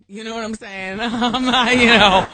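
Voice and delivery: laughing loudly through the words; words breaking into a big laugh. Real, not acted. Host cracks herself up joking she's not McCain, laughing.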